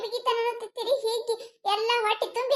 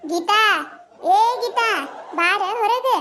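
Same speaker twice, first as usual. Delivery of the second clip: calling out. Acted. Calling neighbour out of house. (ಗೀತಾಳನ್ನು ಜೋರಾಗಿ ಹೊರಗೆ ಕರೆಯುವುದು)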